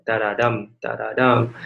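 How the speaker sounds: da-da-dum chanted with the stress on dum; two light beats, then land on dum; rhythmic da-da-dum anapest demonstration. Shown as a demonstration. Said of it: "And Anapest is like this".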